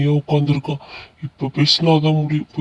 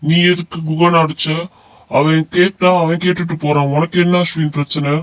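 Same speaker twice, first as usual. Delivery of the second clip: ordinary delivery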